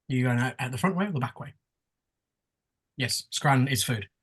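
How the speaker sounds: normal talking voice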